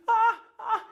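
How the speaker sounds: high alarmed ah ah; panicked cries. Acted. Prince slipping off the horse, high panicked cries. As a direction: yelp as you lose your seat.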